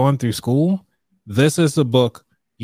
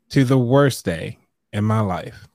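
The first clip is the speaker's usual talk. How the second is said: ordinary delivery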